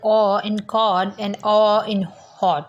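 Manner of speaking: slow, drawn-out "awe" in "cold" and "hot"; open vowel demo. Shown as a demonstration. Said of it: "इसके अंदर इसकी examples हैं". ("awe" को खुले जबड़े से लंबा खींचकर)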